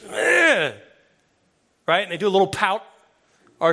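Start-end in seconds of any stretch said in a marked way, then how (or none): 0.0-0.7 put-on high, far disappointed child's groan. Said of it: "And the kid goes".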